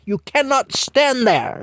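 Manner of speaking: put-on high, loud older British lady shooing a child; Mattie shooing the kid. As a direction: fuss at the kid blocking her telly. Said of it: "So finally she said".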